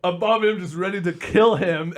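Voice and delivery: normal talking voice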